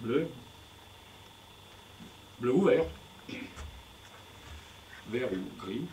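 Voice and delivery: normal talking voice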